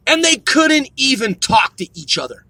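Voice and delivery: ordinary talk, nothing marked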